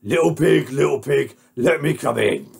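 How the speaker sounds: loud, gruff big bad wolf; menacing wolf. Wolf calls to the little pig, loud and menacing. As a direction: demand entry as the storybook wolf. Wolf calling at pig's door.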